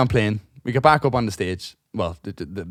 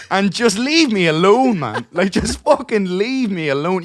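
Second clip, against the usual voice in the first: fed-up rant; 7 semitones higher, 4 dB louder, slower than usual. Real, not acted. Ranting at the drunk man.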